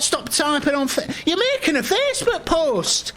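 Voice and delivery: high-pitched, indignant telling-off; exasperated scolding. Acted. Manager spotting a Facebook post, exasperated. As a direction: catch the employee slacking and object. Acted boss scolds typing employee.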